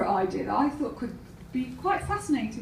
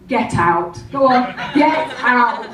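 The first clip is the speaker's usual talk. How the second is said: loud, drawn-out final command; ordering out; 9 dB louder than usual. Retold or imitated. Security ejecting a noisy gambler, loud order. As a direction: throw someone out, slowing on the last order. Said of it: "security to come up and say".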